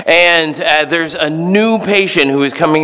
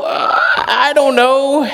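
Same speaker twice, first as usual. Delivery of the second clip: higher, slow unsure uh I don't know; hedge, not convinced; doubtful hesitation; 13 semitones higher, slower than usual. Retold or imitated. Listeners doubting the advice, hesitantly unsure. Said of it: "we go".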